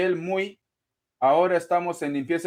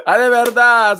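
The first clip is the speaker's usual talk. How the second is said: loud exclamation; 4 semitones higher, 11 dB louder than usual. Real, not acted. Exasperated reaction after laughing.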